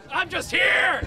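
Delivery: proud high shout; shouting to crowd. Real, not acted. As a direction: yell it out for the crowd to cheer.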